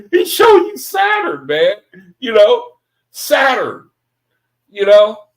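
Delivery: preacher delighting in his own joke, high and loud; excited disbelief. Real, not acted. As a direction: marvel at Saturn, half laughing. Laughing disbelief at the idea.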